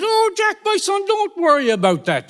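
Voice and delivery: high, falling witch's coaxing; witch's high reassurance. Acted. Witch calming Jack about his punt, high-pitched reassurance. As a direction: start way up high on "oh". Witch soothes Jack's worry.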